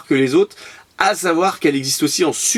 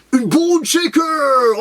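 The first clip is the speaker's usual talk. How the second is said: high, drawn-out showman's announcement; hammed-up reveal; 10 semitones higher, much slower than usual. Vlogger unveiling yet another toy car, hammed-up excitement. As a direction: stretch it out high like a big reveal.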